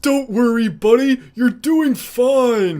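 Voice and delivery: Patrick impression. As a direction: reassure like a dim cartoon starfish. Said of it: "Patrick Starr's top twenty-five".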